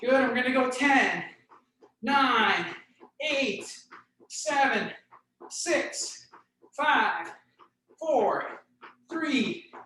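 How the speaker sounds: breathing hard while running in place. Real, not acted. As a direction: count it out between heavy breaths. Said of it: "So I'm breathing a little heavier".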